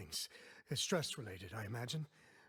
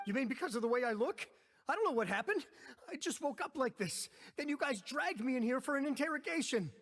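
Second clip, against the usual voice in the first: frantic protest; 10 semitones higher than usual. Acted. Accused man protesting his arrest to the guards, frantic. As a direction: protest in a panic. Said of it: "yelling isn't going to achieve anything".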